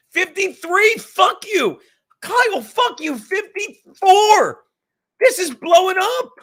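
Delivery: shouting at the chat as viewers pour in; excited shouting. Real, not acted. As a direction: yell it out, thrilled. Viewer count climbing live.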